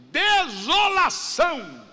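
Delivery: high, loud, slow; father cries out the word "Desolação"; dramatic shout. Acted. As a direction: cry it out slowly like a grieving father. Father naming the son, dramatic.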